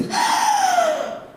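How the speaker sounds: sharp high gasp for air; a dramatic, staged gasp; loud gasp. Acted. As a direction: gasp loudly as if drowning. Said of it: "we breathe and we gasp".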